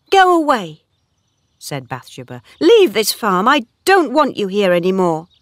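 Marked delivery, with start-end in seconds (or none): 0.1-0.8 high, loud, angry order; angry dismissal. A narrator's reading. Bathsheba orders Oak off her farm, angry. 2.6-5.3 high, loud, angry order; angry dismissal. A narrator's reading. Bathsheba orders Oak off her farm, angry.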